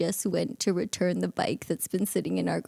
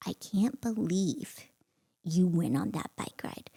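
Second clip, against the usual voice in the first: quiet, drawn out, with a heavy pause midway; slow seething disbelief; 5 dB quieter than usual. Retold or imitated. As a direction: confront a husband slowly, holding it in. Retold confronting husband, slow first take.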